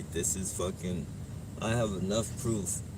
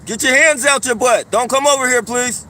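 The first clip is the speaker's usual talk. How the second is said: shouting across the street; loud, firm warning; loud call-out; 17 dB louder than usual. Real, not acted. Telling man to leave.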